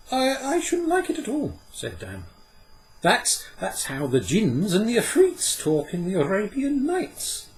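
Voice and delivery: put-on high, loud boy's voice, stumbling; a boy tripping over his words; stammering boy. Dan replies to Puck, high stammering boy's voice.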